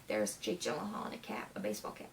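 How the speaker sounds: hushed, suspicious whisper. Retold or imitated. Fan recalling her suspicion of Mysterio, whispered. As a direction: whisper like spotting the villain.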